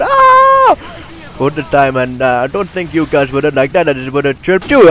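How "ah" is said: long, high, loud "aah"; high-pitched yell. Real, not acted. Commentator breaking off mid-sentence, squealing. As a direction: let out a sustained squealing cry. Sudden cry amid commentary.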